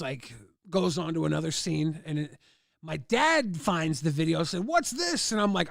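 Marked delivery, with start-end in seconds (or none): 4.7-5.3 high, sharp what's-this; dad catching the kid out, pitched way up; a dad's startled question, squeaked high; mock surprised dad.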